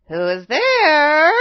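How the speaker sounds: loud, drawn-out disguised wolf voice; hoarse cry. A narrator's reading. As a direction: cry out hoarsely, rising high at the end. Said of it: "cried the hoarse voice from inside".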